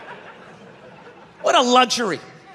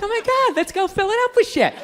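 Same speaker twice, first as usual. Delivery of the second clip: put-on excited girlfriend; 8 semitones higher than usual. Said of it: "They're like".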